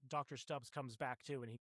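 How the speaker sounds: ordinary delivery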